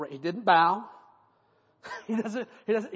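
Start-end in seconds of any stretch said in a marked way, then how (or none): 1.8-2.9 laughing. Real, not acted.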